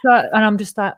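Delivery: plain reading voice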